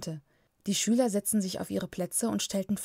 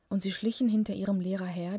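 ordinary delivery, nothing marked

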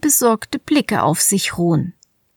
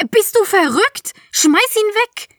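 high shrieking panic; shrill terrified cry to throw it away; panicked; 12 semitones higher than usual. Acted. Kira panics at the beetle.

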